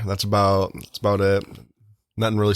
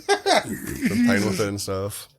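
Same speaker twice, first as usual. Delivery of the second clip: higher, slow, laughing through it; 11 semitones higher, much slower than usual. Real, not acted. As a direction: giggle the words out. Laughing about his hair.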